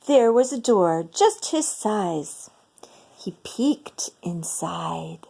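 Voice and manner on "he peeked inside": soft slow storyteller whisper; hushed whisper. A narrator's reading. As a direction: whisper it slowly, like peeking in secret. Peeking secretly into the house.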